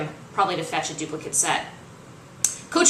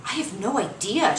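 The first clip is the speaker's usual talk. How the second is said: feigned bewilderment; 2 semitones higher than usual. Acted. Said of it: "trying to sound utterly bewildered".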